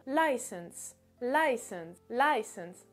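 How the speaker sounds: license with a strong LI, said like "lie", then falling; stress on first syllable. Shown as a demonstration. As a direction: hit the start of license, let the end drop. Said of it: "l'accentuation se fera sur le li".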